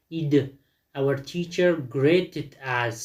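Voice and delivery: slow model sentence with a full -id on "greeted"; -id sound demo. Shown as a demonstration. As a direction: sound out "اید", then "greeted" in two syllables. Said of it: "listen repeat the sound and sentences".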